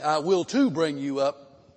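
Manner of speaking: loud, insistent "will too"; emphatic. Shown as a demonstration. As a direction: hit "will too" hard as a firm promise. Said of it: "It's emphatic".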